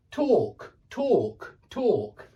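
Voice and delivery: "talk" with a long or-vowel; British talk. Shown as a demonstration. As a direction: repeat "talk" clearly British. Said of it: "the sound that you make in walk".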